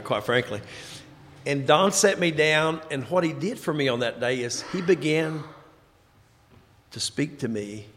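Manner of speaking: ordinary talk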